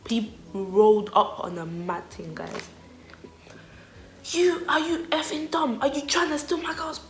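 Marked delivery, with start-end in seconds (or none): 4.3-7.0 higher hammed-up aggressive boyfriend; angry boyfriend. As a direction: confront him like a local tough. Boyfriend confronts speaker.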